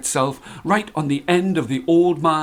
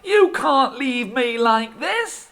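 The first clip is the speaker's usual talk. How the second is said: higher, slow pleading whine; much higher whining husband; whining old man; 13 semitones higher than usual. Acted. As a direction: plead pitifully. Old man protesting to wife.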